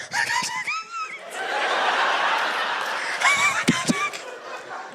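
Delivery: shrieking giggle way up high; helpless stoned shriek of laughter; wild high laugh. Retold or imitated. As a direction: burst into a high squealing laugh. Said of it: "And I was like".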